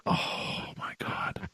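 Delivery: breathless whispered oh my God; awed whisper. Retold or imitated. Host reacts to his hero's kindness, in an awed whisper. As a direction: whisper it, totally starstruck. Awed after Stanton's kind words.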